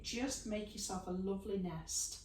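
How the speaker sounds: normal talking voice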